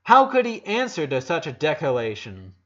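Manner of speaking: ordinary delivery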